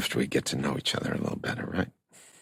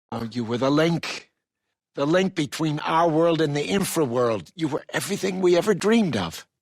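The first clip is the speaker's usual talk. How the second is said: high, exalted declaration; impassioned revelation; 12 semitones higher, 4 dB louder than usual. Acted. Telling Jody she was the dreamed-of link, impassioned. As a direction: voice soaring with fervour.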